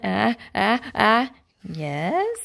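plain reading voice

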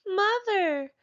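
put-on baby dinosaurs call their mother, high call. A narrator's reading. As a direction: call for mother across the hollow. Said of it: "called the other baby dinosaurs".

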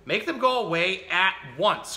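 high, loud storybook demand; mildly hammed-up indignant command; bossy cry. A narrator's reading. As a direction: order it crossly, stamping your foot. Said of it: "cried little Miss Bossy, stamping her foot".

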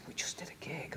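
hushed, voiceless whisper; re-enacting a sneaky late-night call; furtive whisper. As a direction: whisper it down the phone in secret. Secret night call while mum sleeps.

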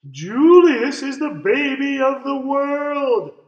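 high, loud, slow sing-song; doting chime. A narrator's reading. Parents adoring baby Julius, doting sing-song chime. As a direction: gush over the baby lovingly. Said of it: "chimed Lily's parents".

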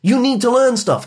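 put-on hustle a kid out the door with orders; nagging parents. Mum and dad pushing their son out the door each morning, hammed-up nagging. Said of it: "My mum and dad would push me out the door".

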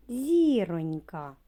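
«зіронька» said slowly and loud, with ЗІ stressed; slow syllable stress. Shown as a demonstration. Teacher to children, slowly sounding out the stressed syllable. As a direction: stretch out «зіронька», leaning on ЗІ. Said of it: "На який склад падає наголос?".

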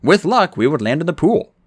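usual speaking voice

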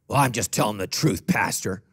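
low put-on congregant voice; mock self-justifying. Congregant excusing harsh words to pastor, mock self-justifying. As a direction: excuse yourself in a deep mock voice.

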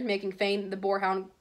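ordinary delivery

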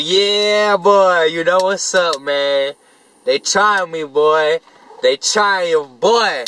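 hyped-up bragging. Retold or imitated. Retelling a fight win, pumped-up bragging. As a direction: brag to a neighbor, pumped up. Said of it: "I'm like".

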